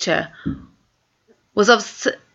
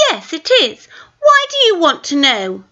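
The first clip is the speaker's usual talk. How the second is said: put-on suspicious girl; 9 semitones higher than usual. Twin suspicious.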